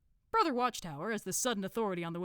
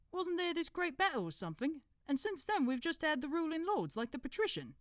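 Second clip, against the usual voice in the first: higher put-on accent for a dim cult member; Brother Watchtower; 11 semitones higher than usual. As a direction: interrupt helpfully in a put-on accent. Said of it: "decided to do an accent".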